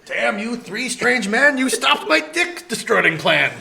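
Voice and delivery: loud, high, drawn-out theatrical curse; mock villainous outrage. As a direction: rail like a foiled cartoon villain. Acted foiled vandal cursing the group.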